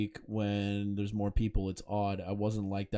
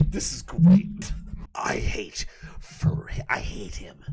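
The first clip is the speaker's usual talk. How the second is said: whisper; 5 semitones higher, 5 dB louder, slower than usual. Real, not acted. Reading message aloud, reacting.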